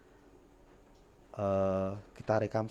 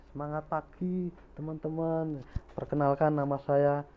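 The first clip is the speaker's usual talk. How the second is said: higher, bright energetic greeting; lively introduction; 6 semitones higher than usual. Shown as a demonstration. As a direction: greet the room with full intonation. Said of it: "Waktu kita membawakan materi".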